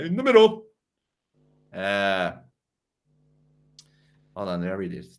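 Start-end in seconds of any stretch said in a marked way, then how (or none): 0.0-0.7 high, louder mimicking voice; mock fence-sitter. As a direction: parrot the line mockingly, pitched up.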